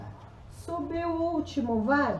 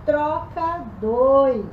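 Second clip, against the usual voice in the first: drawn-out command; 6 dB louder, much slower than usual. Real, not acted. Coach drawing out command.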